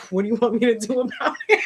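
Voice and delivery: fast retort squeezed through laughter; laughing mock exasperation, rushed and rising; exasperated, laughing. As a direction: blurt it incredulously, cracking up. Retells exasperated reply while laughing.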